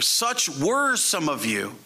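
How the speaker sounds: slow line with "were" stretched out; stress on "were". Shown as a demonstration. Preacher shows the congregation what to emphasize, stressing "were". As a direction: lean long and hard on "were". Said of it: "the thing to emphasize here".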